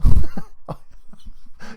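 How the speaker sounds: big laugh at a typo; loud burst of laughter. Real, not acted.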